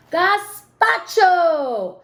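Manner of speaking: drawn-out, high triumphant gazpacho; excited exclamation. A narrator's reading. Narrator revealing the soup idea, slow and thrilled. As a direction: stretch it out like a big reveal.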